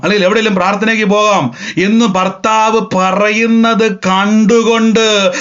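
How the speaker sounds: high, driving sermon voice with stretched key words; impassioned preaching. Real, not acted. Preacher urging listeners to think in the spirit, impassioned.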